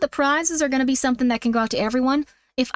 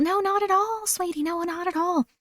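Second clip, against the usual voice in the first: put-on sweet Southern accent; 6 semitones higher, 3 dB quieter than usual. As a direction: play a doting Southern lady soothing chat. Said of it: "Is my accent a joke to you people?".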